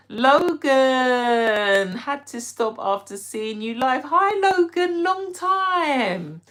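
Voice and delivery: high, loud delighted hello; excited greeting. Real, not acted. Greeting Logan back after a long time, high delighted.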